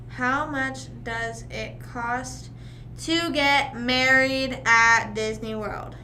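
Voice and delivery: slow, deliberate dictation. Shown as a demonstration. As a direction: spell the search out slowly. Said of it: "let me look up".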